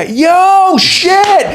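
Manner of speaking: high, loud whoop of recognition; excited shout. Retold or imitated. His friends recognising the comic, shouting in excitement. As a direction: yell it like a thrilled fan. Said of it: "They go".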